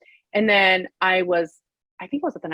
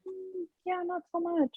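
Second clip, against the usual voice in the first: high, soft, dismissive letdown; unimpressed; 10 semitones higher, 11 dB quieter than usual. Retold or imitated. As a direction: shrug it off with a pitched-up meh. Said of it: "I'm like".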